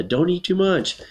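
high-pitched mock yell; yelled warning. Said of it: "yells Kate's aunt".

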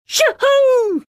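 high excited shout of glee; joyful whoop. Acted. Hero flying with Shahra, high gleeful whoop. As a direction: whoop it out high as you take off.

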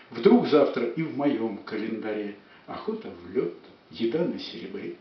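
lively, rhythmic verse reading; poetry declamation. Acted. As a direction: recite with playful relish. Poem declaimed.

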